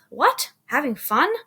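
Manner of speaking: high, loud puzzled questions; confused. A narrator's reading. Jill puzzled by Mary's objection, confused. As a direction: ask it baffled, pitch jumping up. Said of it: "Jill says, confused".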